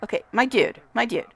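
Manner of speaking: usual speaking voice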